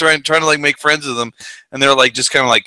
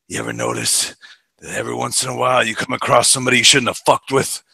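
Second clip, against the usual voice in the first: Clint Eastwood growl; 2 semitones lower, 6 dB quieter, faster than usual. Retold or imitated. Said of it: "he has this one line".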